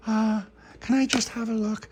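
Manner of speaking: put-on very high girlish voice; high child's plea; eager little girl. Charlotte begs to see birdie.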